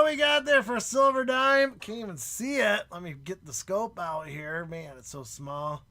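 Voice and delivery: ordinary talk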